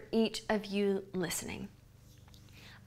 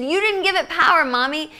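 put-on quick, higher and louder complaining kid; mother mimics her son's indignant complaint; whiny kid; 5 semitones higher, 7 dB louder than usual. Mother retelling how her son whined at her, quick and loud.

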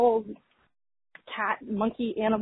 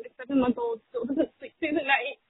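ordinary talk, nothing marked

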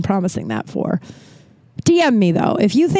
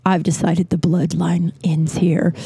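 hushed deadpan; 4 semitones lower than usual. Retold or imitated. Telling someone who asks about kids, in a hushed whisper. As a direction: breathe the line out flat and low. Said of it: "you just say".

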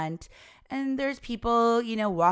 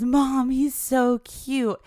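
high, loud, drawn-out gushing; excited gushing; 6 semitones higher, 4 dB louder, much slower than usual. Retold or imitated. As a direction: gush like a kid begging over a puppy. Child gushes over a puppy.

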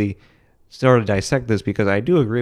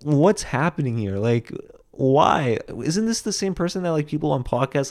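higher, baffled and exasperated; incredulous disbelief; 5 semitones higher than usual. Retold or imitated. Reacting to a comic's bad set, in baffled disbelief. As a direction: ask it like you can't believe what you're seeing. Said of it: "And I'm like".

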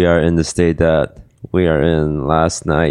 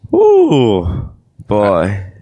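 excited whoop; 3 semitones higher, 3 dB louder than usual. Acted. Hungover host feigns revival.

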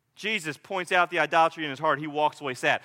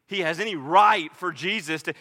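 ordinary delivery

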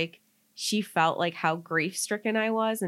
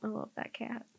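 tender murmur; 13 dB quieter than usual. Real, not acted. Remembering her beloved late cat, softly tender.

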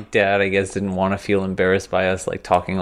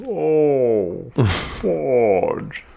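very drawn-out, higher slow-motion exclamation; 5 semitones higher than usual. Acted. Retelling Ralphie's slip in the movie, in slow motion. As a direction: stretch each word out like slowed-down film. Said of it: "In slow motion, too".

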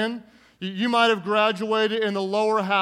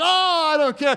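put-on high, loud brush-off from a boy who missed; defiant kid; 7 semitones higher, 5 dB louder than usual. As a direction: shrug off the miss loudly, as a stubborn kid. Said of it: "it's like the kid who".

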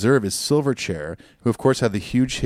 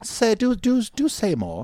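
high, slow put-on curiosity; hammed-up eager interest; mock intrigue; 13 semitones higher, slower than usual. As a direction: coax in an exaggerated high voice. Continues mock intrigue bit.

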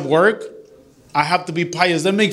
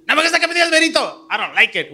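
high, fast mock whine of his wife; imitating wife's protest; 10 semitones higher, much faster than usual. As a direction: squeak out her objection, quick and high.

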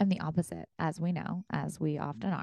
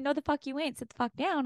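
higher, blunt voice of her own body; body's stern voice; 8 semitones higher than usual. Retold or imitated. Her body overriding her in therapy, blunt and higher. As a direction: voice the body refusing her, firmly. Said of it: "My body's saying".

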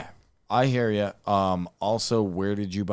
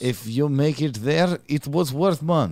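pitched-up Russian airline salesman; Russian accent; 6 semitones higher than usual. Pretend. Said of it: "I thought you were from Russia".

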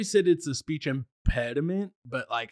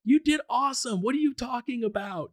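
high, reassuring praise; cheering friends; 11 semitones higher than usual. Retold or imitated. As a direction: lift him up like an admiring friend. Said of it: "They're like".

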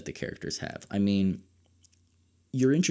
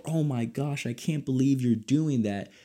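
mock horrified; 6 semitones higher than usual. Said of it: "every time you're just screaming".

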